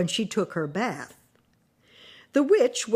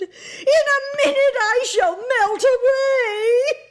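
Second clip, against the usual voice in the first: high, loud, tearful witch wail; dying witch wailing as she melts; crying witch; 6 dB louder than usual. A narrator's reading. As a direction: cry it out in panic. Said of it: "she screamed".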